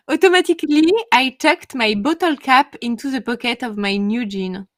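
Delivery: loud, deliberate French accent in English; showy French-accented English. Shown as a demonstration. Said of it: "profitez-en de mon magnifique accent".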